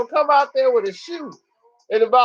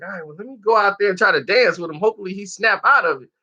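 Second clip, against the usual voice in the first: fast, resolved mutter; hurried Marlon; 3 semitones lower, faster than usual. Retold or imitated. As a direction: rush it, making a plan. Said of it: "Marlon was like".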